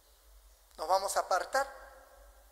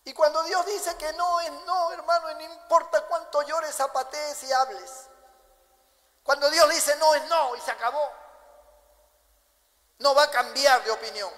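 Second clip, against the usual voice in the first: high, loud pulpit declaration; fiery preaching; 7 semitones higher, 6 dB louder than usual. Acted. Preacher tells the brethren God's no is final, full force. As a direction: hammer it home to the congregation.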